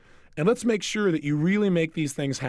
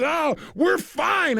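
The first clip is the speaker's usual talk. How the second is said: high laughing protest; 11 semitones higher, much slower than usual. Retold or imitated. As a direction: insist you're fine while cracking up. Woman laughing, insisting fine.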